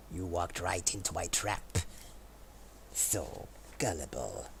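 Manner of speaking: villain voice. Acted. Gloating at trapped player.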